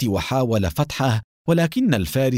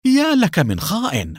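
higher-pitched, shocked accusation; astonished cry; 6 semitones higher than usual. A narrator's reading. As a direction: exclaim in startled outrage. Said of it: "الذي صاح بدهشة".

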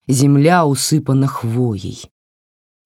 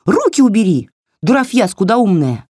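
higher, snappy little doll telling her off; scolding doll voice; 7 semitones higher, 4 dB louder than usual. Acted. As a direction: scold like a cheeky tiny doll. (высокий бранчливый голосок куклы)

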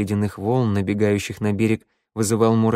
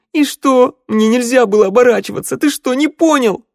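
very high, loud hysterical shriek; breaking into shrieking; 13 semitones higher, 8 dB louder than usual. A narrator's reading. Sanda snaps back at being contradicted, shrieking. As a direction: screech the retort. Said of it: "Санда сорвалась на визг".

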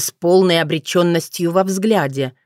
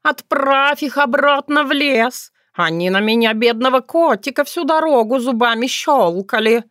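high, plaintive cat whine; howling cat; 7 semitones higher than usual. A narrator's reading. Cat complaining about the bears, plaintive wail. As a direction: wail with exaggerated self-pity. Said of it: "взвыл кот".

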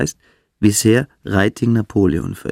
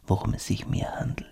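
soft, voiceless whisper; 9 dB quieter than usual. Acted. Beineberg lets Törless in on his aim, whispering. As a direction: let it sink in quietly, like a secret.

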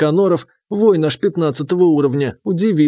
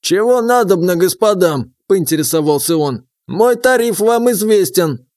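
put-on higher, sly old man; old prospector; 3 semitones higher than usual. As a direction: play the crafty old informant.

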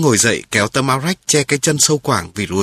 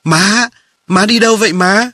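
high-pitched, raised call to mother; child crying out; 9 semitones higher, 4 dB louder than usual. A narrator's reading. Younger brother calls to his visiting mother, crying out. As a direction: cry out in a high, eager rising voice. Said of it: "rồi nó la lên".

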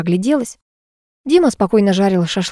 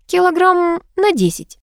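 high, slowly drawn-out condition; name your price coyly, stretching the words; airy, pitched-up tease; teasing demand; 11 semitones higher, slower than usual. Acted. Teasing condition set to suitor.

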